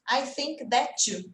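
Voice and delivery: slow model phrase with "that too" glued together; linked sounds. Shown as a demonstration. Teacher models joined sounds for the class, "that too" linked. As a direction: say "that too" as one joined word. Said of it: "Uma junção de som aí".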